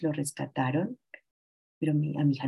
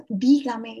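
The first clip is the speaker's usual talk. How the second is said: retold shout; 5 semitones higher, 6 dB louder than usual. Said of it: "y le grité".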